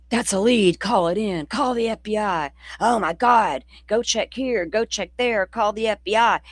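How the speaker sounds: mock the panicky chat commenters; frantic chatters. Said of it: "I've seen it ... in certain chats".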